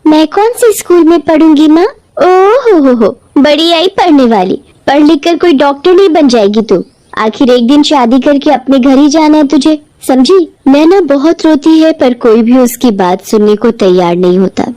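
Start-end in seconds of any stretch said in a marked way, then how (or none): 0.1-1.9 put-on little girl voice. Daughter pleads with mother. 2.2-10.5 mocking mother. Mother mocks daughter.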